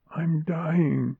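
put-on high, faint-hearted young girl's voice; dying girl. A narrator's reading. Dying girl to her mother, giving up. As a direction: give up in a high voice.

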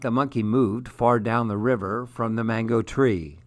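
ordinary delivery, nothing marked

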